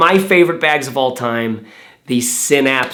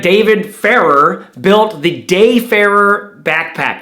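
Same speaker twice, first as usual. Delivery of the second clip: usual speaking voice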